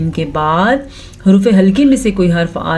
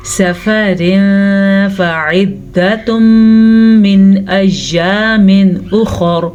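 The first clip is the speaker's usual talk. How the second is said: slow, measured tajweed chant; drawn-out Quranic recitation; much slower than usual. Shown as a demonstration. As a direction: recite each syllable slowly and clearly. Recitation resumes.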